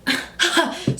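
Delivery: breathy laughter with no voice; giggle, losing the thread; airy flustered giggle. Real, not acted. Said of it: "I'm flustered".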